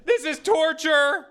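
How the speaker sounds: very high, loud, drawn-out mock wail; hammed-up shriek. Streamer imitating horrified stream chat, hammed-up shriek. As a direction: wail in exaggerated horror as the outraged chat. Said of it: "chat like".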